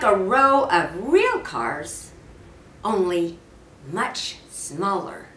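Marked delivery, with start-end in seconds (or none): none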